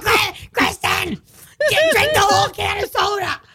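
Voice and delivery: high, slow scolding mom voice; mom's voice. Retold or imitated. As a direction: nag like a stern mother. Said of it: "That's my mom's voice".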